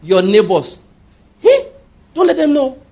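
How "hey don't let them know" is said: high sudden hey then hushed warning; mock secret warning. Preacher mocking fearful believers, high hey. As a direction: playfully warn them to keep it secret.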